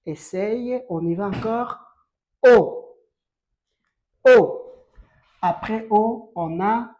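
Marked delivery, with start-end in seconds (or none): 2.4-3.0 "O" called very loud with rounded lips; closed-mouth vowel O. Shown as a demonstration. Teacher drills pupils on "O", loud with closed rounded lips. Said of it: "Tu fermes vraiment la bouche". 4.3-4.6 "O" called very loud with rounded lips; closed-mouth vowel O. Shown as a demonstration. Teacher drills pupils on "O", loud with closed rounded lips. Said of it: "Tu fermes vraiment la bouche".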